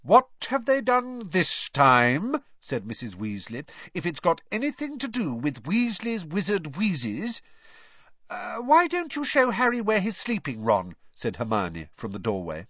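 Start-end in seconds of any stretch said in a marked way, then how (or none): 0.0-2.4 slow, loud, high-pitched demand; exasperated mother demanding answers, drawn out; angry mother. A narrator's reading. Angry mother interrogates her husband. 3.9-7.4 high-pitched, suspicious warning; angry mother. A narrator's reading. Angry mother continues her warning. 8.3-10.9 high-pitched, deliberate suggestion; hinting girl. A narrator's reading. Girl hints from doorway to leave.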